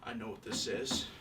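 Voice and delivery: low, soft dawning realization; muttered quietly. Acted. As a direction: mutter it to yourself. Dawning realization, first take muttered.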